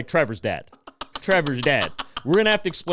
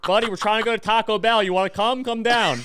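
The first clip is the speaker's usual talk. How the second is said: calling up the stairs; 6 semitones higher, 4 dB louder than usual. Retold or imitated.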